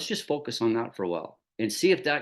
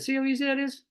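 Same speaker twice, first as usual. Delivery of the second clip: gleeful boast; 12 semitones higher than usual. Retold or imitated. Boasting to new colleague.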